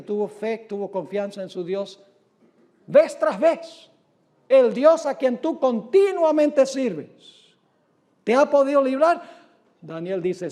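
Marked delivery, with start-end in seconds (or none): 2.9-9.4 slow, higher and louder pleading question; a touch of the king's anguished cry. Acted. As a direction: lean into the king's plea. Preacher re-voicing the king's cry.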